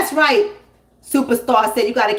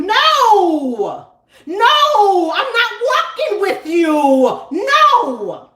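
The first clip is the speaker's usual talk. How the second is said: high, loud yelling no; panicked refusal; 8 semitones higher, 4 dB louder, much slower than usual. Acted. Comedian refusing to walk a stranger, yelling. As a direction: yell it to shut them down.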